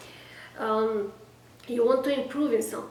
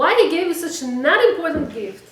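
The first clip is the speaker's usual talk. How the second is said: loud, fast, higher mock complaint; hammed-up indignation at the husband; upset wife; 5 semitones higher, 10 dB louder, faster than usual. Wife complains about her birthday gift, mock upset. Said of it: "And you get so upset".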